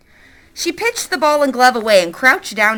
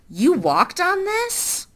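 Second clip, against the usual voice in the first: woman asks, higher and slow, startled; a touch of shock at the foot; mild surprise; 9 semitones higher, much slower than usual. Acted. Woman voiced in story.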